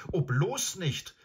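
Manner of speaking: viel höher, langsam, flehend; ängstlich abwehren; ängstlicher Protest. Acted. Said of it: "protestierte Shasta". (Shasta wehrt sich ängstlich gegen den Galopp)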